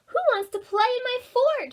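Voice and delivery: calling out. A narrator's reading. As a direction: call across the yard. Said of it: "she called".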